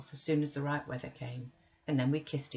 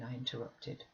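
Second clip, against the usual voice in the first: ordinary delivery, nothing marked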